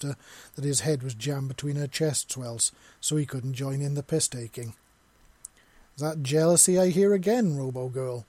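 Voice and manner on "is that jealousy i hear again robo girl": sharp retort. A narrator's reading. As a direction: fire the jab at her. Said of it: "Visa shot".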